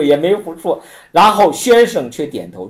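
normal talking voice

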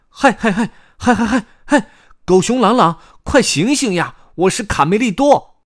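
high, loud calling-out; 高声叫醒. A narrator's reading. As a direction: shout to wake a sleeping bear. 卡梅利多叫醒狗熊. (高亢响亮地呼喊、催人醒来)

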